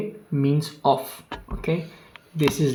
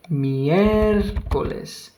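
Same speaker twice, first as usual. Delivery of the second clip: Miércoles slow with the stress held hard on the mié; exaggerated stress; 3 semitones higher, much slower than usual. Shown as a demonstration. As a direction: lean heavily on the mié of Miércoles, slowly. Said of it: "on the E".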